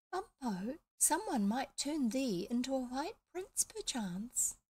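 put-on high, small voice like a little girl; a sneaky parrot putting on a little girl's voice. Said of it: "talking in a small, high voice, like a little girl".

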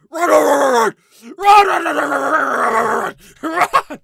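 loud urgent repeated yell; frantic shout. Real, not acted. Player fleeing the teacher, frantic shouted urging. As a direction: yell at yourself to run.

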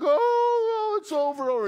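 high, loud hammed-up wail of grief; mock wailing. Preacher imitating mourners wailing over Lazarus, high mock wail. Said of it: "weeping there is a strong".

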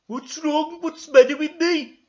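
loud, very high panicked character; frantic high-pitched questions; Ermintrude. Acted. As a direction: cry out in mock alarm. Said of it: "said Ermintrude".

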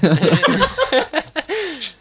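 big high burst of laughter; loud laugh. Real, not acted. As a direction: crack up loudly.

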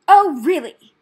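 very loud, high outrage; loud, offended exclamation; indignant gasp. A narrator's reading. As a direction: burst out affronted. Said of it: "Rat gasped indignantly".

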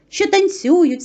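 ordinary delivery, nothing marked

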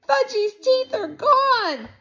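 very high, loud wail; screaming in horror. A narrator's reading. Girl confirming the teeth are lost, screaming. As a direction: shriek it, stretching the end. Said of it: "Sheila screamed".

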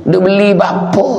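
high-pitched mock parental scolding; cross parent. Preacher imitates parents upset over firecrackers, mock scolding. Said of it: "pak dengan mak tu dah".

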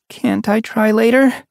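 put-on high hopeful young cat; hopeful mew. A narrator's reading. Leopard Paw begs her friend, high and hopeful. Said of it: "she mewed hopefully".

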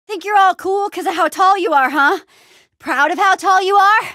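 high, cocky goading; loud mocking challenge; taunting. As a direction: taunt a towering opponent. Taunts a tall opponent.

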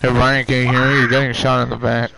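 calling out loudly to a teammate. Real, not acted. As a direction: call out loud over the mic. Calling to teammate under fire.

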